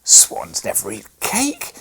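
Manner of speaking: loud, slow, scornful retort; a boy snapping down his sister's dream; rough interruption. A narrator's reading. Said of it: "interrupted Fred somewhat roughly".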